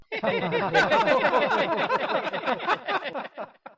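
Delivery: loud, high burst of laughter; long cackling laugh swelling toward cheering. Acted. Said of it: "Das Gelächter schwoll an und wurde zu Jubel".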